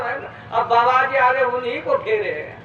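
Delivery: ordinary delivery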